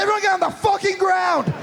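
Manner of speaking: teacher barking orders at the class, shouted; a fed-up teacher now screaming at the kids; mock armed teacher yelling. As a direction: yell it like a teacher who has lost it.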